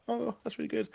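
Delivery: high, soft impressed listener. Retold or imitated. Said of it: "some people are like".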